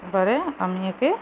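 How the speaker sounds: ordinary talk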